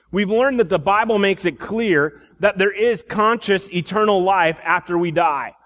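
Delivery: ordinary talk, nothing marked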